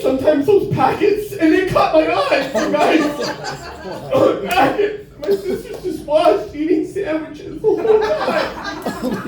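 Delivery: high-pitched, hammed-up distress; mock breakdown. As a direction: play a traumatized wail, pitched very high. Mock breakdown recalling mayonnaise packets.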